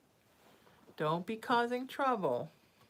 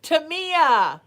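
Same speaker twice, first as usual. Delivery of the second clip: loud, higher triumphant call of the name; proud loud shout of the name; excited exclamation; 9 semitones higher, 11 dB louder than usual. Real, not acted. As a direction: nail the name with glee. Proudly saying name correctly.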